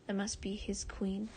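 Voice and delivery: ordinary delivery, nothing marked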